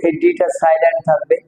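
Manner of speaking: usual speaking voice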